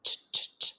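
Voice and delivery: ch, ch, ch said as soft rapid unvoiced hushes; quick hushing ch sounds like chatter. Shown as a demonstration. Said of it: "creating a sound that's similar to ch".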